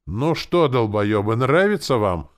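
loud, high sergeant's taunt; bullying shout. A narrator's reading. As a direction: demand it of the recruits.

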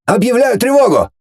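urgent, very high announcement; raising the alarm. A narrator's reading. General reacts to news of intruders, alarmed. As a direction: pitch it high, sound the alarm.

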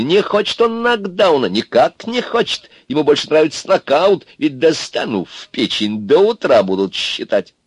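high-pitched, taunting threat; exclaiming. A narrator's reading. Курносый threatens Аристарх with a knockout, exclaiming. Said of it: "тоже воскликнул Курносый".

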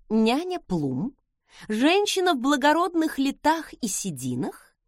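plain reading voice

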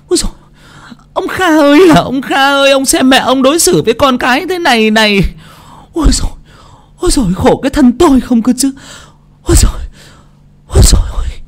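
loud high wail then breathy whispered moan; high wailing lament. A narrator's reading. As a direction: wail about her lot, hammed up. Wife wails self-pity.

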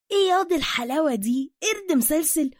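high, loud gleeful praise; admiring excitement. Acted. Suka cheering Bruce's climb, excited and loud. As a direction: marvel in playful Cairene slang.

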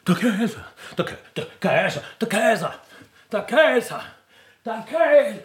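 crowd buzz building to a cry; rising excitement. Acted. Crowd passing the news along, building excitement.

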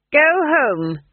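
high, loud dismissal; curt public command; loud order. A narrator's reading. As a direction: project it to a crowd. Granny orders the crowd away.

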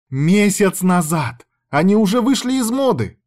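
high, stretched-out dramatic protest; overacted outrage. A narrator's reading. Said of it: "Ты сейчас переигрываешь".

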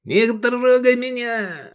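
very high, slow angry cry; snarling outburst. A narrator's reading. Zealot shakes off his companion, snarling. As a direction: snap back, voice shooting up. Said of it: "окрысился Тураб и выхватил меч".

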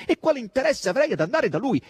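very high, loud protest; loud dismay. A narrator's reading. Margherita protests to Asasello, loud dismay. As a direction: cry out the question, affronted. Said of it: "a voce così alta".

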